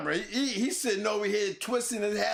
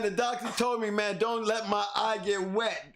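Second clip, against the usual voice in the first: usual speaking voice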